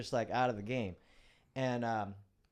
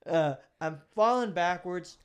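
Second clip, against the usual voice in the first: laughing; 6 semitones higher, 9 dB louder, slower than usual. Real, not acted. Laughing after story moment.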